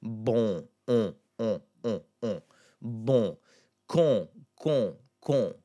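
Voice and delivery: "bon", "on", "con" with a nasalized oh; separate, deliberate nasal O syllables. Shown as a demonstration. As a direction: drill "on" through the nose, one at a time. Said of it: "start with the nasal sound".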